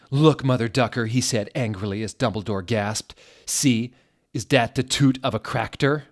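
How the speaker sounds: a loud hammy telling-off; mock angry rant. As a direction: bark at the headmaster. Angry retort to Dumbledore.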